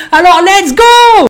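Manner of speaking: loud, high, slow let's go; hyped slow call to start; hype launch. Real, not acted. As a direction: stretch it out like a kickoff. Launching into the chapter.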